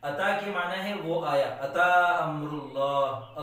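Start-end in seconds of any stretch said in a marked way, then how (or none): 1.7-3.4 slow, lowered Quranic recitation; teacher intoning a Quran verse to students, slow and solemn. Shown as a demonstration. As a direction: intone it reverently and slowly. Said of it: "Quranic phrase recited".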